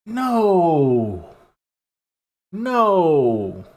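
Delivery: long, falling no; drawn-out dismay. Pretend. Streamer appalled by bootleg-looking shoes, in slow mock dismay. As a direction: stretch the vowel and let it sink.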